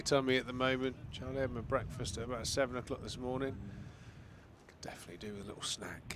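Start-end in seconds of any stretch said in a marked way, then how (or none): 4.7-6.2 whispered aside. Real, not acted. Hungry aside about breakfast.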